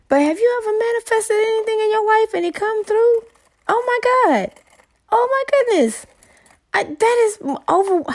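high-pitched gushing excitement; overjoyed. Real, not acted. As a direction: gush as if overwhelmed with joy. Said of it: "I couldn't be even more excited".

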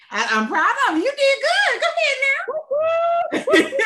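high, loud laughing encouragement; laughing shout of praise. Real, not acted. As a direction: cheer her on through laughter. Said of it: "see, I caught it".

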